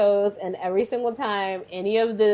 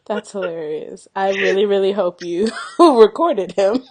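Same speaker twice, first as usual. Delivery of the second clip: usual speaking voice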